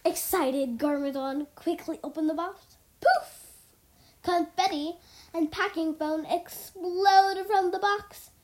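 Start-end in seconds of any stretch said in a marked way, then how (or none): 3.0-3.5 high-pitched, explosive poof; loud poof. A narrator's reading. The box bursts with confetti, high loud poof. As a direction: pop it out suddenly.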